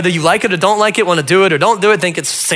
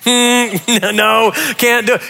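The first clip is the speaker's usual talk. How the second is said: doubtful refusal; 4 semitones higher, much slower than usual. Retold or imitated. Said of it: "You're like".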